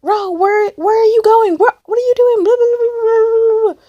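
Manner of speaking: very high mock-mom nagging; frantic mom. Acting mom's frantic phone calls.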